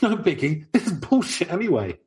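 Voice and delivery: high mock-casual inner voice; brushing it off in a high voice. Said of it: "I immediately thought".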